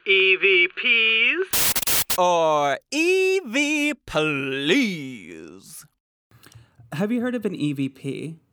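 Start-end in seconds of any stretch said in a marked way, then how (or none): 0.1-5.8 drawn-out, loud game-show style intro; big, slow announcer flourish; segment announcement. Pretend. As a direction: stretch it out like a hammy segment title. Announces segment with mock jingle.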